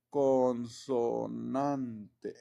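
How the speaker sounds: very slow, lengthened syllables; drawn-out dictation. Real, not acted. Teacher writing the consonant rule, drawn-out dictation. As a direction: stretch the word as you write. Says word slowly while writing it.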